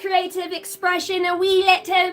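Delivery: put-on British. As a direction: stretch the vowels in a playful fake British accent. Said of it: "trying to be British at the same time".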